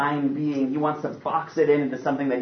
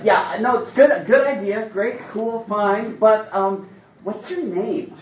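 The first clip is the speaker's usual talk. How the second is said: awkward mock Moses; 9 semitones higher, 3 dB louder than usual. Mock Moses dodging God awkwardly.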